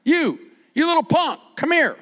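high, loud, brash call; rude summons. Retold or imitated. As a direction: bark it like a rude boss. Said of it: "He doesn't say".

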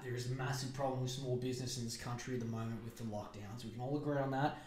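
ordinary talk, nothing marked

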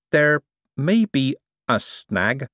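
hesitant and slow, breaking bad news; slowly. A narrator's reading. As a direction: draw it out slowly, word by word. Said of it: "said Adora Belle slowly".

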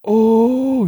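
a high, loud drawn-out "oh"; the crowd taunting after the punch; mock crowd oh. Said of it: "everybody's like".

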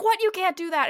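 high, loud, appalled "what, you can't"; shocked outcry. Retold or imitated. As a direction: gasp it out, scandalized. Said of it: "and everybody is like".

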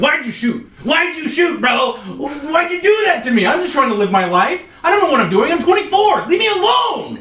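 high-pitched shouting, climbing to the end; anguished protest. Acted. Man under fire in the war zone, anguished shouting. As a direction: build from aggrieved cry to full yell. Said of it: "people are taking shots at us. And we're like".

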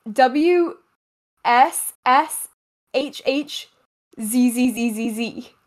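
spelling out a sound, letter by letter. Shown as a demonstration. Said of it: "spell that sound for me".

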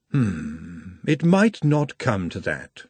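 put-on slow, thoughtful older woman; Madame's voice. A narrator's reading. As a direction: muse slowly, knowingly. Madame speaking.

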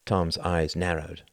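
plain reading voice

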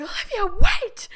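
a high, loud cry of the name, wait shouted; calling out. Retold or imitated. Drunk ex stopping her from leaving, a high loud call. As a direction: yell after someone walking away.

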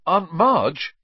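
sudden high, loud outburst; blurted out. A narrator's reading. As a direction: blurt the name out in alarm. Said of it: "he blurted out".

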